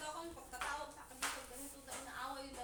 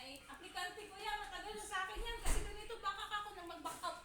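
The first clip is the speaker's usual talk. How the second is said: higher, raised mock boss voice; mimicking the boss; 7 semitones higher, 5 dB louder than usual. Maid mimicking her boss on the phone, higher and raised. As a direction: imitate sir defending his applicant. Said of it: "Sabi niya".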